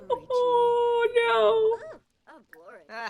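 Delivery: high squealing laugh into a mock cry of oh no. Real, not acted. Player reacting to a console option, squealing laughter. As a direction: crack up, then wail through the laughter.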